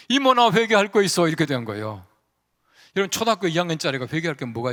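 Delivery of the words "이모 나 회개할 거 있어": put-on higher and louder, a little boy blurting it out; the boy suddenly gives in; child's eager reply. Burned boy answers his praying aunt, high and loud.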